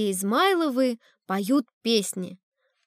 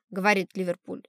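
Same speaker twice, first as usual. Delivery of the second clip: ordinary delivery, nothing marked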